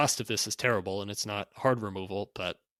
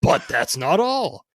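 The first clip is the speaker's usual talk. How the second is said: slow, loud drawn-out barker reveal; carnival barker; 3 semitones higher, 8 dB louder, slower than usual. Acted. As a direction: stretch it out for the big reveal. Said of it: "read this in sort of a carnival barker type voice".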